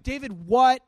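loud, shocked disbelief; incredulous king. Retold or imitated. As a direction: stop him cold, stunned he'd even try. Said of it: "He's like".

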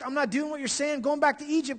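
high-pitched mock defiance; rebellious refusal. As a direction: act out stubborn people refusing orders. Preacher voices rebellious people.

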